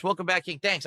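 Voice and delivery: ordinary delivery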